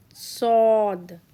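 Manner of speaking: Sod with a full, heavy S and a dark O. Shown as a demonstration. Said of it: "like S but heavy".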